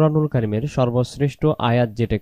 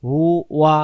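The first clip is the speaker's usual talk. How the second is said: usual speaking voice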